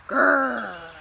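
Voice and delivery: rolled, sustained grrr; dog growl. A narrator's reading. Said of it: "Rosie growls".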